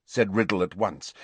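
ordinary delivery, nothing marked

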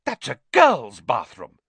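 mildly gasped, loud disbelief; gasping shock. Acted. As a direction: gasp it out, scandalised. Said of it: "he gasped".

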